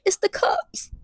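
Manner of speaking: high whimpering whisper; small imitated crying voice; mock tearful whisper. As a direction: whimper it softly through pretend tears. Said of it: "I got tears all down my face".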